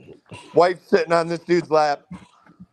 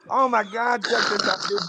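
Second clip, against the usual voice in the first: imitated giddy excitement; 3 semitones higher than usual. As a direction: act out gushing over a new toy. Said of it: "biting his fucking knuckle".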